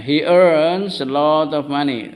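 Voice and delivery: slow, drawn-out teacher's model sentence; repeat-after-me model. Shown as a demonstration. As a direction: stretch each word for the class to repeat.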